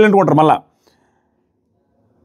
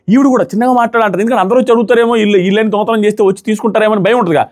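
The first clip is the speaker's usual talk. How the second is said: ordinary talk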